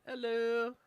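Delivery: high, loud sing-out hello; friendly scale. Acted. Switched-on scale greets its owner, friendly high voice. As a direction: greet brightly as a cheery little machine. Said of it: "The scale is so friendly".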